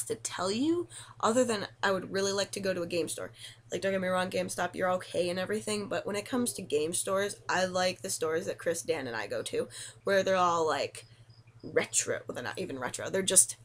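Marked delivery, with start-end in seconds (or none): none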